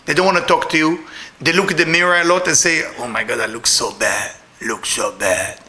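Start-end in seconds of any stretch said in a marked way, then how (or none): 3.0-5.6 very low, soft imitation of self-disgust; mock dejection. Clubber facing the mirror after the party, low mock misery. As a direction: groan it low and soft, dragging out the end.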